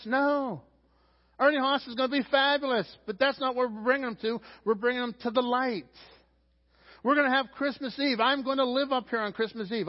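high, loud sermon voice, driving each line; a pastor urging his church toward the light, fervent preaching. Acted. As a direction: build the church up to the big point. Preaching at peak, higher pitch.

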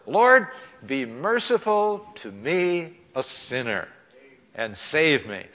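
high, slow pleading prayer; earnest prayer. Retold or imitated. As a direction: plead slowly up to the Lord. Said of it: "I said those magic words".

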